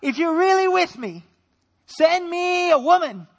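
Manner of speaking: loud, high, slow mock begging; hammed-up plea for a wife; desperate prayer. Young man begging God for a woman, hammed-up plea. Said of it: "that's how we started praying".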